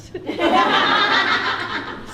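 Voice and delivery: loud burst of laughter. Real, not acted. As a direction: laugh off the punchline. Laughs after "Great.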